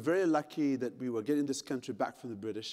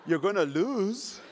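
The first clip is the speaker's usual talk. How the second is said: drawn-out teasing of the runners; mock pity; 2 semitones higher, slower than usual. East African teasing American marathon runners, mock pity. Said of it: "I'm like".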